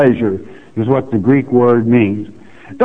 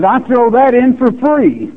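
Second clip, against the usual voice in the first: high, light voicing of God's offer; high playful divine voice; 11 semitones higher than usual. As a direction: toss it off lightly in a raised voice. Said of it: "He says".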